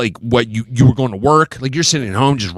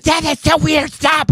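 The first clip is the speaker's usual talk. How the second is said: put-on grossed-out kid voice; 11 semitones higher, slower than usual. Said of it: "They're like".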